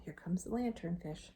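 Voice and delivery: usual speaking voice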